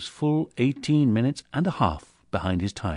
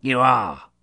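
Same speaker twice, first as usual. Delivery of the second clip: stern repeat; 4 semitones higher, 7 dB louder than usual. Acted. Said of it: "repeated Scrooge".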